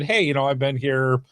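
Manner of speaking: ordinary talk, nothing marked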